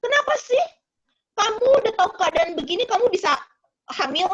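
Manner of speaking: high, exasperated retold accusation; husband reproaching wife. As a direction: act out a husband blaming his pregnant wife. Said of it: "Lalu suaminya ngomong".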